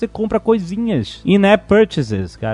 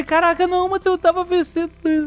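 frustrated whine; 10 semitones higher, faster than usual. Retold or imitated. Frustrated player voiced.

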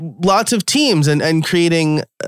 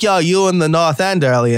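put-on Boston accent; 4 semitones higher than usual. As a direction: mimic a chatty Boston guy's accent. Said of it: "this is very stereotypically Boston".

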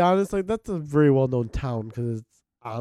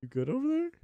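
high teasing check-in; 10 semitones higher, 6 dB quieter than usual. Acted. Teasing a friend about her ear.

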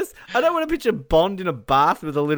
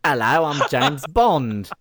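slow Cockney geezer Bond; drawn-out Cockney introduction; Michael Caine; 4 dB louder, much slower than usual. Acted. Bond introduces himself, Michael Caine Cockney impression. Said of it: "speaking like a Cockney geezer, Cockney Bond, like Michael Caine".

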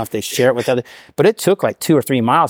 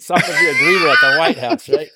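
laughing; 6 semitones higher than usual. Real, not acted. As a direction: laugh it out, pitched up. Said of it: "laugh shout".

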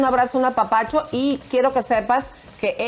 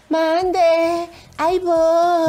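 high, strained sick voice; odd covid voice; 8 semitones higher, 3 dB louder, much slower than usual. Retold or imitated. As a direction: answer in a strange high voice. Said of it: "se le hizo así que le".

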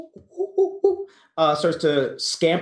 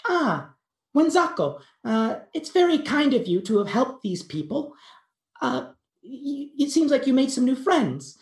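much higher, small creature voice; spirit talks to the monkey; 11 semitones higher than usual. Acted. Bush baby spirit praises the monkey, high tiny creature voice. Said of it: "looks down at the monkey and says".

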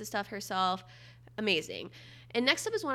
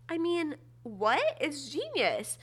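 amazed; 5 semitones higher, slower than usual. Real, not acted. Amazed at a gadget.

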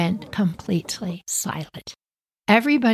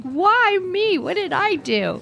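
whiny high protest; 11 semitones higher than usual. Retold or imitated. Johnny protesting.

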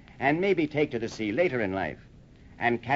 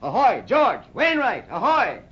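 loud hail across the street; calling out; 10 semitones higher, 6 dB louder, slower than usual. Acted. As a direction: shout for the mate. Captain calling out to mate.

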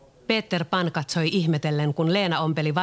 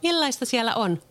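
put-on curious girl; 7 semitones higher than usual. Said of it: "Leena kysyi".

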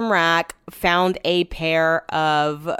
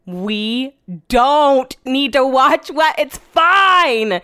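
exasperated; 7 semitones higher, 6 dB louder, much slower than usual. Retold or imitated. Wife exasperated at husband.

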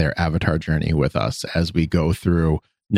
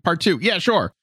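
higher, breezy shrug of a voice; dismissive; 9 semitones higher than usual. Retold or imitated. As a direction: brush it off carelessly. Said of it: "And like".

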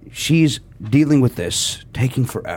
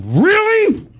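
incredulous really. Retold or imitated. Retelling his indignant reaction to being skipped in line. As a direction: let it soar in outrage. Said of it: "And I go".

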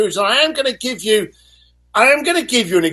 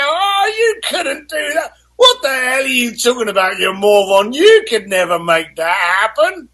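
mocking friend; 3 semitones higher than usual. A friend mocking your goals in a bar, scornful. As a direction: sneer it like a friend belittling you.

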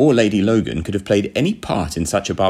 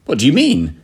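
raised, higher-pitched demand; rather too loud; 6 semitones higher, 4 dB louder than usual. A narrator's reading. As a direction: blurt the question a bit too loudly. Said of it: "I said, rather too loudly".

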